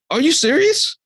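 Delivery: can't believe what the governor just did; incredulous. Real, not acted. Host to co-host, mocking the governor in high disbelief.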